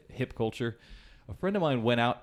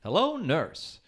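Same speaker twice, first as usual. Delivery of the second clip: vaudeville doctor; 6 semitones higher, slower than usual. Retold or imitated. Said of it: "the doctor always said in a doctor sketch".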